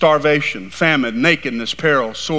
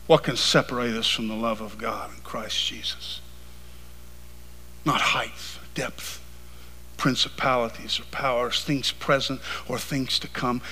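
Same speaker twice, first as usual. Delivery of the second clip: low, very soft scripture recital; hushed preaching; 5 semitones lower, 10 dB quieter than usual. Real, not acted. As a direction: bring it right down, nearly to a whisper.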